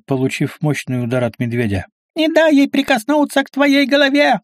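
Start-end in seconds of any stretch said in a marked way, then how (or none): 2.2-4.4 very high-pitched squealed warning. A narrator's reading. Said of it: "взвизгнула Астарта". (пронзительный визг)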